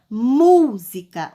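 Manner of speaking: música with a long, loud MÚ; stress on MÚ. Shown as a demonstration. Teacher presenting the example word, MÚ stressed in música. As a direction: punch the first syllable of música. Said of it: "qual é que eu pronunciei com mais força".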